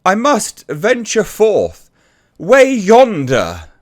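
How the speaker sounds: high, slow, loud faux-old-English ham; mock thespian dwarf. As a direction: drag out each archaic word like a bad stage actor. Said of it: "female dwarf".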